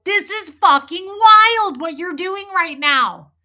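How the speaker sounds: incredulous outburst. Retold or imitated. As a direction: spell out each word in disbelief at him. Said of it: "I would say that".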